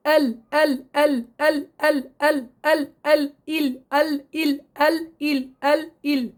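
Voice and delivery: rapid ال، ال with the tongue tip only; quick lam drill. Shown as a demonstration. Teacher drills ال fast for the student. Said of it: "جيبيها بسرعة".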